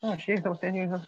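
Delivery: mock surprise at a forgotten anniversary; sudden realization. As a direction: catch yourself remembering the date late. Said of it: "I was like".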